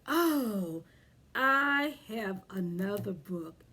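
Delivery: plain reading voice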